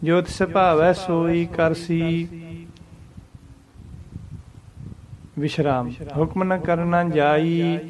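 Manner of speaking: slow recitation resting once after ਕਰਸੀ; ਜੋ ਤਿਸ ਭਾਵੈ ਸੋਈ ਕਰਸੀ, rest, ਹੁਕਮ ਨ ਕਰਨਾ ਜਾਈ; one pause after ਕਰਸੀ. Shown as a demonstration. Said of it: "ਇੱਕੋ ਹੀ ਵਿਸ਼ਰਾਮ ਆਊਗਾ ਕਰਸੀ ਦੇ ਉੱਪਰ". (ਕਰਸੀ ਤੋਂ ਬਾਅਦ ਇੱਕ ਵਿਸ਼ਰਾਮ)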